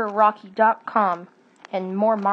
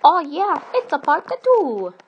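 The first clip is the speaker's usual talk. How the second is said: high, punchy excitement; excited announcement; 7 semitones higher, 5 dB louder than usual. Acted. Storyteller reveals a sequel to listeners, excited and high. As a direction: announce it with high-pitched glee.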